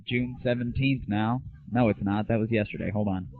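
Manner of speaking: ordinary talk, nothing marked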